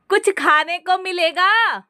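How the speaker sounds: high, slow call from another room. A narrator's reading. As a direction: call out for food. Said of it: "फिर आवाज लगाई".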